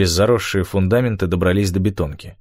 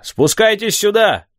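high, slow, carrying call; shouted summons; 13 semitones higher, 4 dB louder, slower than usual. A narrator's reading. Hidden man calls the boys down the slope, shouting. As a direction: call them over from far away.

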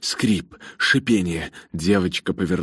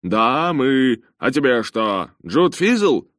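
loud, high-pitched, gruffly delighted reply; happy holler to an old acquaintance; joyful bellow; 8 semitones higher, 5 dB louder, slower than usual. A narrator's reading. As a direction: bark it back happily, rising on the name. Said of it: "радостно рявкнул в ответ Джонатан".

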